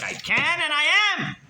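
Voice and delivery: high, ringing mock retort; defiant. Congregant answering the outraged shul, defiant.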